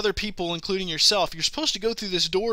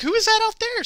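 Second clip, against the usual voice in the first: much higher, startled question; baffled surprise; 5 dB louder than usual. Retold or imitated. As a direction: ask it as if the game is haunted. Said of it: "I was like".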